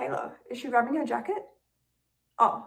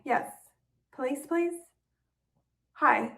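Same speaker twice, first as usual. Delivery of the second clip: normal talking voice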